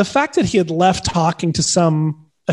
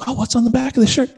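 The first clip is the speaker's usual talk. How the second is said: quick, high imitated curiosity; retold curious question; 7 semitones higher, faster than usual. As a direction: ask it fast and high, intrigued.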